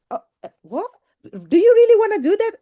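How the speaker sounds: ordinary talk, nothing marked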